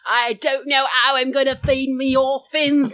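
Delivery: hammed-up East End urchin whine; Cockney accent. Friend mocking the movie character, broad Cockney. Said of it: "Dropping his H's".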